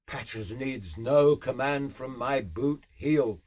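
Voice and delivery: ordinary delivery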